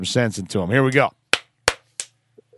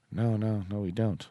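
bookstore clerk; 3 semitones lower, 11 dB quieter than usual. Pretend. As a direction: pretend clerk, low and soft. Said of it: "They say".

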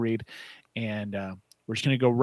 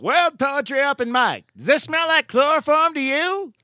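big booming announcer voice; 13 semitones higher than usual. Acted. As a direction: boom it out like a fight announcer. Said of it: "that is my announcer voice".

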